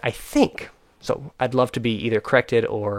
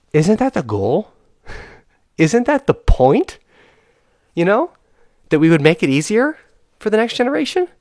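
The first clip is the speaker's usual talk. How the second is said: exasperated outburst; 9 semitones higher, 5 dB louder than usual. Real, not acted. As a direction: plead in disbelief, pitch climbing. Exasperated rant about the generation.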